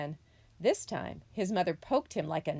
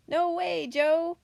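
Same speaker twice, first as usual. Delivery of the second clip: high, louder motherly refusal; scolding mother possum; 12 semitones higher, 7 dB louder, slower than usual. A narrator's reading. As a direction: scold the little one in a raised pitch. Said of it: "Mother Possum scolded".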